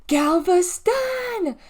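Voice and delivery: high, loud, drawn-out name; howling. Acted. Deli howls at her sister while being carried upstairs, howling. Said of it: "she howled".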